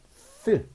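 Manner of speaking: 쓰 as air escaping over the bitten tongue; voiceless TH. Shown as a demonstration. Teacher showing TH's leaking-air sound, voiceless TH. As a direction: bite the tongue and blow out 쓰. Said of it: "바람 빠지는 소리를 냅니다".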